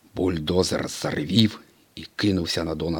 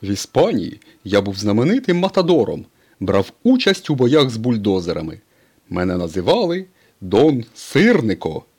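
higher, louder proud tow-truck voice; proud Mater; 4 semitones higher, 6 dB louder than usual. A narrator's reading. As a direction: boast like a cheeky old tow truck. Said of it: "гордо повідомив він".

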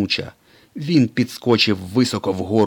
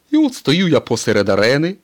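higher, louder storytelling tow truck; Mater telling his tale; 7 semitones higher, 8 dB louder than usual. A narrator's reading. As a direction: spin the tale like a bragging old truck. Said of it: "продовжив розповідь Сирник".

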